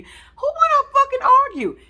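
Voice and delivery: high, loud, exasperated yelp; incredulous outburst. Real, not acted. Commenting on a couple who never argue, high loud incredulous outburst. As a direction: blurt it out in disbelief.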